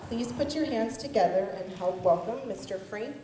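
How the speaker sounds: ordinary talk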